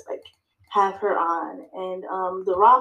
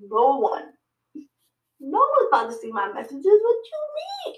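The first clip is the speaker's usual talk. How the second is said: incredulous disbelief; 7 semitones higher than usual. Retold or imitated. Answering God's advice, high incredulous protest. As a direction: pitch jumps way up on "what you mean". Quoting herself answering God.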